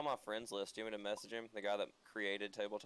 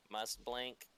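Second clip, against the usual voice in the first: glitching robot; 5 semitones higher, 11 dB louder, slower than usual. Pretend. Robot Zuckerberg impression.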